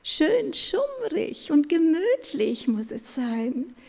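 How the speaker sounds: a touch of a cozy owl. A narrator's reading. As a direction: owl wanting dim cozy light. Owl keeps talking.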